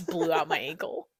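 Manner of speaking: ordinary talk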